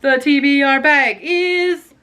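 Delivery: dramatic host voice; suspenseful announcer. Real, not acted. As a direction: stretch it like a game show reveal.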